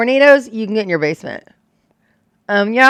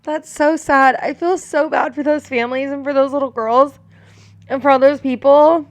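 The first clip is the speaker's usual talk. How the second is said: high, teary voice; grieving, higher and teary; tearful; 6 semitones higher than usual. Real, not acted. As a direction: voice pitched up with tears. Crying over lost campers.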